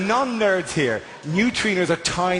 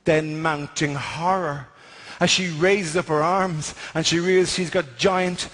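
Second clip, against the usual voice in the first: lower, grave epic narration; mock trailer voice; 4 semitones lower than usual.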